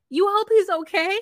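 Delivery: loud, high, rising disbelief; incredulous echo. Acted. Host scolding the deceived woman, loudly incredulous. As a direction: throw it back as an outraged question.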